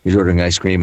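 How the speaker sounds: usual speaking voice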